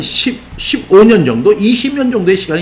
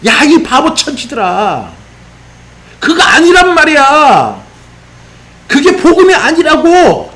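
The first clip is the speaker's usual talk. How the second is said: acted outburst climbing to a high, stretched shout; 흥분한 호통; 9 semitones higher, 4 dB louder, slower than usual. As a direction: scold slowly, building louder and much higher. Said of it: "지금 흥분해가지고 이렇게 얘기할 거예요". (흥분해서 점점 높여 길게 외치는 호통)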